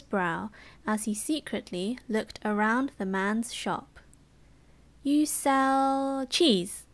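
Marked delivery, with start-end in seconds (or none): 5.0-6.9 drawn-out psychic guess. Acted. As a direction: stretch it like a stage mystic. Genie fakes psychic reading.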